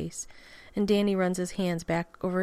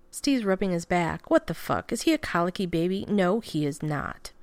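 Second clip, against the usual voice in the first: usual speaking voice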